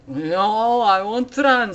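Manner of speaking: high, loud acted protest; defiant refusal. Demon answering the monk back, loud defiant refusal. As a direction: belt out the demon's stubborn refusal.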